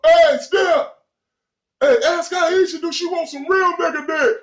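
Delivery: high, loud mocking heckler voice; heckler taunt. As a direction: jeer at the star across the street.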